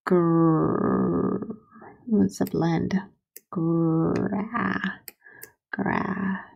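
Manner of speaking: Gr- and Gra- stretched, building to grass; sounding out grass. Shown as a demonstration. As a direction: blend the sounds of "grass" slowly. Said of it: "Grass is just one syllable".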